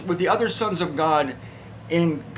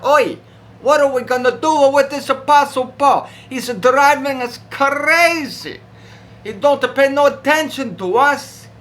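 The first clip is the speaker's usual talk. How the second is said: higher, louder demon grumbling in frustration; the demons throw up their hands at Paul; exasperated demon; 9 semitones higher, 7 dB louder than usual. Acted. Demons fed up with Paul, loud exasperated whine.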